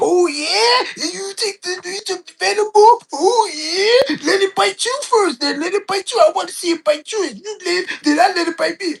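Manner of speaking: crank it up high and wild like Macho Man; Macho Man impression. Daring Jake to get bitten first, high wild yell. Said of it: "Macho was like".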